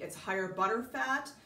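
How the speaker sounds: usual speaking voice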